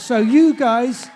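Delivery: loud, drawn-out call to the crowd; rally the room loudly; booming host addressing the audience. Real, not acted.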